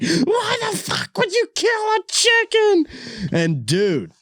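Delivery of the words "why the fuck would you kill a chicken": high drawn-out mock scream; retold scream. Girlfriend screaming at him for killing the chicken. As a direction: scream it in horror at the driver. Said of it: "she's screaming".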